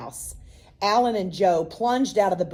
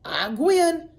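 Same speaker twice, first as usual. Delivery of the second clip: high yell through a mouthful of worm; yelling with mouth full; 6 semitones higher, much slower than usual. A narrator's reading. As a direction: shout while still chewing. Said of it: "still chewing".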